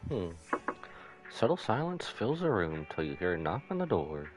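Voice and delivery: ordinary talk, nothing marked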